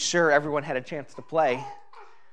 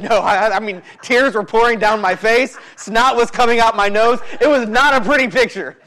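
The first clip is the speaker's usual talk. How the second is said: high, loud mock indignation, building; emphatic retold protest; 8 semitones higher, 9 dB louder than usual. Husband correcting wife about how hard he cried, loud emphatic protest. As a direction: hammer out each admission, raised and comic.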